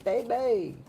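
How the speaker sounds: high laughter into a gleeful exclamation; real, high-pitched gleeful laugh; delighted laughing. Real, not acted. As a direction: burst out laughing, then crow it in delight. Delighted by a confirming card.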